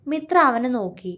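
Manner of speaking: plain reading voice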